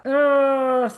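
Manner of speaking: loud, high frustrated ugh; exasperated groan. Retold or imitated. After the sales call, groaning at the cost, exasperated. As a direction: let out a groan of dismay.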